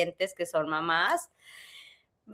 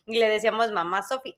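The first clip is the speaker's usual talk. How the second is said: normal talking voice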